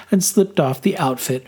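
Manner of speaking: usual speaking voice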